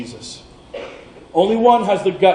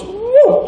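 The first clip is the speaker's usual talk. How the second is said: much higher whooshing noise; sword swoosh; 4 dB louder than usual. Retold or imitated. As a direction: imitate the blade flying by.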